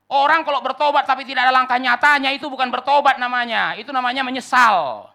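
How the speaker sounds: fiery pulpit climax; preaching at full force. Acted. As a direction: pound home the call to real action.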